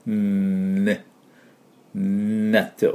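"ね" and "納豆" opening on a held N; tongue behind teeth, hum into "ね" and "納豆"; long nasal N. Shown as a demonstration. Teacher demonstrating N with air through nose, long N. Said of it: "鼻から息を抜きます".

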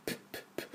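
voiceless little puffs of "プップップッ"; P sound. Shown as a demonstration. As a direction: pop each "プッ" as a bare, soft P. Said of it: "Pの音".

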